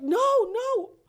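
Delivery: very high, loud wail of disbelief; imitating a screaming sister. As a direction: scream in horrified denial. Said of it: "she was screaming like".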